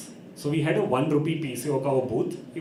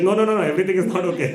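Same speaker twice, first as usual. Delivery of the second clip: loud, higher mock panic; hammed-up frantic no; urgent denial; 5 semitones higher, 6 dB louder, much faster than usual. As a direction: blurt out an alarmed correction. Son urgently contradicts dad.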